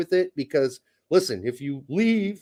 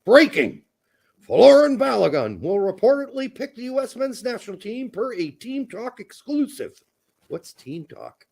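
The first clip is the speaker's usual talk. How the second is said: put-on old-time newsreel announcer, high and bright; 1950s news voice; 7 semitones higher than usual. Shown as a demonstration. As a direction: read it like a fifties radio bulletin. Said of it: "That's my nineteen fifties news voice".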